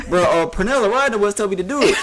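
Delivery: high whining blame; mock whiny excuse. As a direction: play the caught kid passing blame. Kevin blaming friends.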